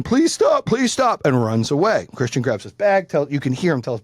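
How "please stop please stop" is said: high-pitched imitation of a crying kid; mock high pleading; mock crying plea. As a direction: retell the begging in a much higher voice. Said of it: "the kid's crying".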